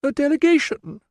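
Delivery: very high squeaked question. Acted. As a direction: squeak it out in alarm. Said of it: "Sabina's squeak".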